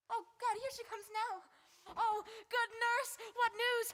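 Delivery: high, urgent pleading; eager excited. Acted. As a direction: beg for the news breathlessly. Juliet eager for news.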